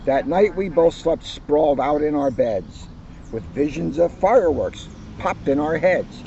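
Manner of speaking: put-on cheerful children's book narration; story reading. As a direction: read it like story time for kids. Reads picture book aloud to audience.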